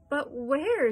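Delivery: loud, high wail on the question; shrieked question. A narrator's reading. Mother asking where her daughter went, shrieking. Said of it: "shrieked Missus Salt".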